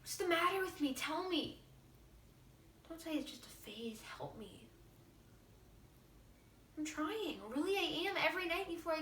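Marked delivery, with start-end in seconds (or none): 4.2-4.7 whispered plea. Acted. Desperate plea to father.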